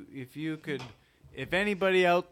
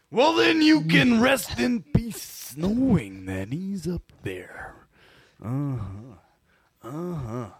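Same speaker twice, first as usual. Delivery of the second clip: hammy wrestler promo, swooping pitch; Randy Savage. Acted. Eulogising a dead wrestler in his own style, theatrical impersonation. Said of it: "[name] doing the amazing, amazing impersonations tonight".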